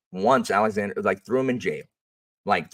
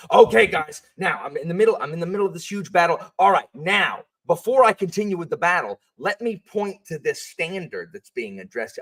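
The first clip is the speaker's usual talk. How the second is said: higher, exaggerated teacher voice interrupting his own story; mocking pedantic teacher; 9 semitones higher, 4 dB louder than usual. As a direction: parody a stiff by-the-book teacher. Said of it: "and be like".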